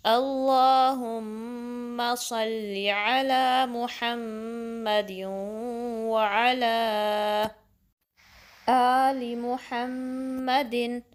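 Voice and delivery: slow, drawn-out chanted recitation; measured tajweed recitation; slow Quranic recitation. Shown as a demonstration. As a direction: recite it carefully, stretching the long vowels.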